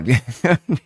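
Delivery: caught-out giggle; embarrassed laugh. Real, not acted. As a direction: break off into a laugh. Laughs at the teasing question.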